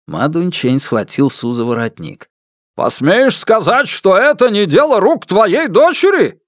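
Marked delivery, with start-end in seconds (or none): 2.7-6.4 high, loud, threatening question; angry accusation. A narrator's reading. Father grabs the man's collar, angry accusation. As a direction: challenge him hard, pitch well up.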